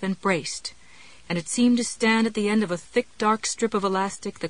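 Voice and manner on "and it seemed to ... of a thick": ordinary delivery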